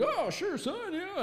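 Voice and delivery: high-pitched put-on dad voice; obliging dad. Voicing the unsuspecting dad's reply.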